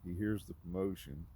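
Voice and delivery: ordinary talk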